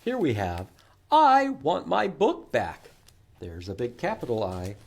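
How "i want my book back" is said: title read out louder and higher, slowly. Acted. Librarian showing children a book title, loud and slow. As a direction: announce the title with gusto.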